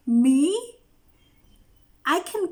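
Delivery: laughing, disbelieving. Real, not acted.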